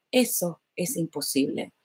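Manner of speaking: hushed, heavy quote; hushed voice of reality. Retold or imitated. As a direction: let reality whisper its verdict. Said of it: "la realidad decía".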